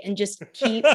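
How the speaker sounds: ordinary delivery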